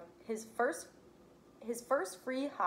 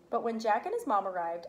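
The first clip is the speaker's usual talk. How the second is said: ordinary delivery, nothing marked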